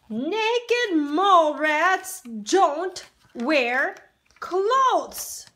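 slow, loud, emphatic lecture; lecturing slowly. Acted. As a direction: spell out the rule word by word. Mole rats lecturing.

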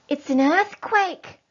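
loud, slow alarmed cry; cried out. Acted. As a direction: sound the alarm to the family. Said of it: "cried Missus Fox".

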